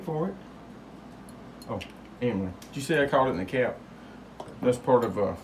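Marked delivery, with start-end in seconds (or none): none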